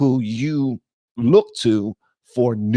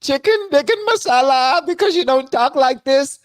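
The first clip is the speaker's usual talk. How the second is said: high-pitched put-on Indian accent; mock Indian accent; 12 semitones higher than usual. Host mimicking the man in the clip, mock Indian accent. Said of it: "I'm about to pull some voices out".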